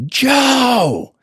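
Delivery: high, loud call up the stairs; yelling a name. Retold or imitated. Mom yelling up to her son upstairs, loud call. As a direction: shout the first syllable to the upstairs bedroom. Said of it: "She'll yell".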